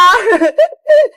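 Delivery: high little laugh; anticipating giggle. Acted. Girl about to cut open the parcel, high giggle.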